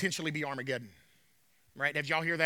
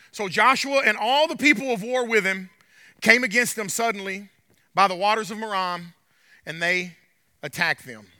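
scripture reading; 2 semitones higher, 6 dB louder than usual. Acted. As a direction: proclaim the verses to the congregation. Reads scripture aloud.

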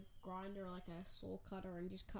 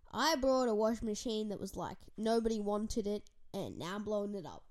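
loud excited; 10 dB louder than usual. Pretend. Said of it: "walk outside and be like".

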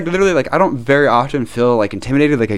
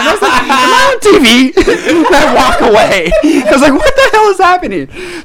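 laughing disbelief; 5 dB louder than usual. Retold or imitated. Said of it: "he slides down his hand".

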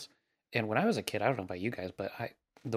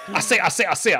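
loud, high, blustering Southern cartoon rooster; Foghorn Leghorn; 8 semitones higher, 11 dB louder than usual. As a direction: boom it out like a pompous cartoon Southerner. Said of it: "everybody in North Carolina's foghorn leghorn".

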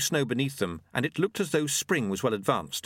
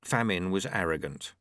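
plain reading voice

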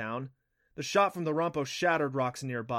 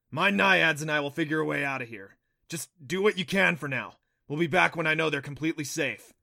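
louder, lifted voice, firm instructions; Neff, the kelpie; 4 semitones higher, 6 dB louder than usual. A narrator's reading. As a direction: give parting orders loudly.

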